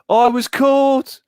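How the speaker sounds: slow, loud sung Brummie parody; appalling Birmingham accent. Acted. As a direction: belt it out, drawn-out and high, in a hammed-up Brummie accent. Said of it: "That was my appalling Birmingham accent".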